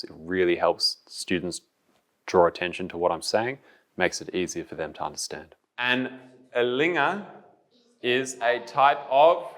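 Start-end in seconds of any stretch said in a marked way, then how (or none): 5.8-9.5 higher, drawn-out prompt with a pause between parts; calm, slow teacher voice. Real, not acted. Teacher prompting the class to complete a sentence, calm and slow. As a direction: slowly lead the class to the answer. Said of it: "my tone is calm and my voice is slow".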